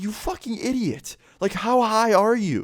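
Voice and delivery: very high, incredulous telling-off; exasperated mock scolding. Teller berating stoned friend in the hole, high exasperation.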